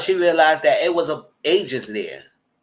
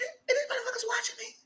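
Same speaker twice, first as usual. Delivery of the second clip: high paranoid hush; 8 dB quieter, faster than usual. Retold or imitated. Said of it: "I said".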